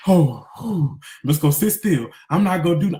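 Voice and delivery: ordinary talk, nothing marked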